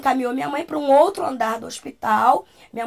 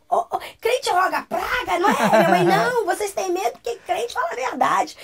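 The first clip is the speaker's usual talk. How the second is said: retold heated retort, pitched up; indignant retort; 6 semitones higher than usual. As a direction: act out a defiant comeback. Mother's acted retort to mockers.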